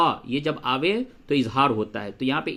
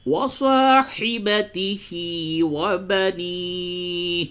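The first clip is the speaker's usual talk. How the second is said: slow chanted tajweed recitation; Quran recitation; 3 semitones higher, much slower than usual. Acted. Teacher recites the verse slowly to students.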